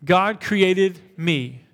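slow call-and-response affirmation; slow unison declaration. Shown as a demonstration. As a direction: lead "God created me" slowly, leaning on "created". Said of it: "ready to say it with me".

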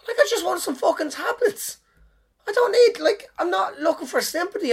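high, strained and exasperated. Real, not acted. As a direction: let the frustration squeak out. Exasperated ranting retort.

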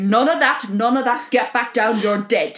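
stern scolding. Retold or imitated. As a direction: scold him back down like a naughty dog. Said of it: "And she's like".

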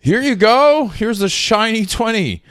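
high, loud, slow taunting voice; mocking a smug rider. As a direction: hold out the twenty like a treat, mocking. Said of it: "folded it like".

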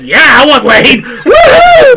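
laughing, loud, soaring up into a silly woohoo; mock excited whoop. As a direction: whoop it like a giddy fool. Mocking Skeletor's eager agreement.

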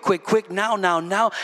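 usual speaking voice

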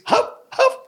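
high yapping dog barks. Shown as a demonstration. Rabbi showing how the dog barks, high yapping. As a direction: bark like a small dog. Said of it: "The dog bark like this".

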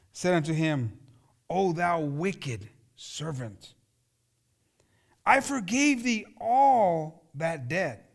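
measured, solemn Bible reading from the pulpit; scripture reading. Acted. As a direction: read the parable slowly and gravely. Reads the parable aloud.

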